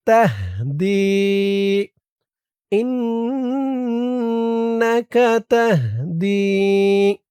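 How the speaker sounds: drawn-out, melodic dua chanting; slow Quranic-style recitation of a prayer; slow Arabic recitation. Shown as a demonstration. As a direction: recite each syllable long and lilting. Recites the phrase.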